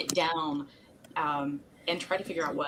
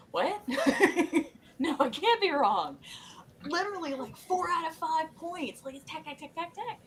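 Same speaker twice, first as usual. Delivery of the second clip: high-pitched mock disbelief with a laugh in it; squeaky-high, half-laughing protest; incredulous protest; 11 semitones higher than usual. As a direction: imitate a flustered scientist who can't believe it. Acting out Stamets's flustered disbelief.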